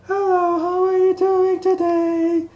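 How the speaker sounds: chirpy high falsetto hello, slow; Mickey Mouse voice. Shown as a demonstration. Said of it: "That's how I practice my Mickey Mouse voice".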